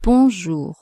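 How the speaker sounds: "bonjour" with nasal "on" and no English N. Shown as a demonstration. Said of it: "You have to say".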